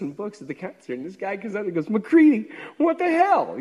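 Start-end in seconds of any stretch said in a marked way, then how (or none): 1.9-3.6 high, loud cry of disbelief; mock shocked shout. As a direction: yell his name like you can't believe it. Friend shouting surprised at concert.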